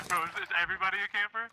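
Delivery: ordinary delivery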